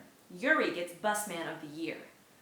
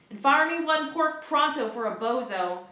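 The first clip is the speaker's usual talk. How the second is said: loud, much higher shout; 8 semitones higher, 10 dB louder than usual. Acted. Cook shouting as orders pile in, loud high shout. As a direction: cook bellowing over the rush, louder and higher. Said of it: "Braverman shouts".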